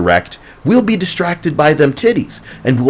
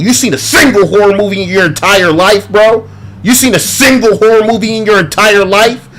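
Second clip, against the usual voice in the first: louder, higher yelled challenge; loud rant; 6 semitones higher, 4 dB louder than usual. Real, not acted. Yelling at horror fans who praise the movie, loud. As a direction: shout it at the fans.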